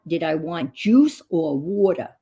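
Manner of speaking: ordinary talk, nothing marked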